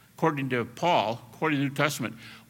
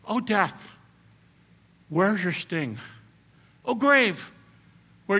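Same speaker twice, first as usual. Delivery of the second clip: poetic recital; 5 semitones higher, slower than usual. Retold or imitated. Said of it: "Paul is not speaking like a poet".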